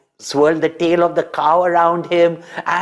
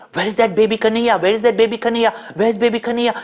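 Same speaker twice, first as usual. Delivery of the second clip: high, fast, anxious calling; urgent worried cries; alarmed searching; 7 semitones higher, much faster than usual. Acted. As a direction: villagers frantic for the lost baby.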